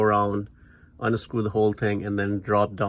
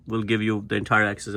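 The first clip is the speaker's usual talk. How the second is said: ordinary talk, nothing marked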